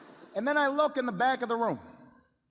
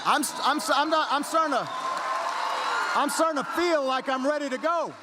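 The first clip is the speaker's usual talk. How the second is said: loud, high, rousing build-up; rally speaker catching the chant's energy; getting fired up; 5 semitones higher, 5 dB louder than usual. Retold or imitated. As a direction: stammer into a fired-up shout. Mock building excitement over cheering crowd.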